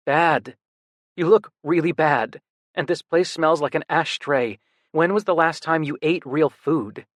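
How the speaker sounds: a worried brother refusing to back off; insistent younger brother. Acted. Brother voiced in story.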